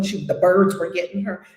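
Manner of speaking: ordinary talk, nothing marked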